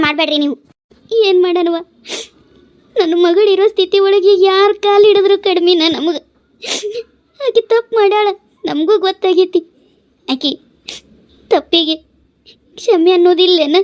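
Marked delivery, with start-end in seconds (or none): 3.0-13.8 high, tearful wail of a desperate mother; weeping plea. Acted. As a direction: beg for mercy while crying. Mother weeping, asking for forgiveness. (ಗೋಳಾಡುತ್ತಾ ಕ್ಷಮೆ ಬೇಡುವ ಧ್ವನಿ)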